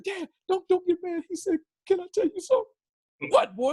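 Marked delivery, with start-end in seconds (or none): 0.0-1.2 put-on very high kid voice; imitated young son. Son's plea to father, retold. 1.9-2.7 put-on very high child voice; imitated young son. Son asking father, retold. 3.3-3.7 high, angry dad snap, imitated; mock-angry dad in a comedian's voice; Bernie Mac. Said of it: "You sound like Bernie Mac when you mad".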